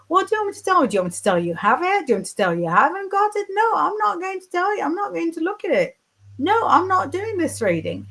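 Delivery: high, fast mock rant refusing the reading; retold shouting psychic. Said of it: "She was shouting down the phone".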